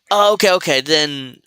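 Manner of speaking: sarcastic retort. Real, not acted. Answering the clip with loud sarcastic okays. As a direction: bite back with fake agreement.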